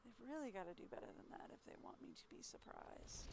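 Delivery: fast, breathy whispered repeat. Shown as a demonstration. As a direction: say it again under your breath, quickly. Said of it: "repeated as whisper".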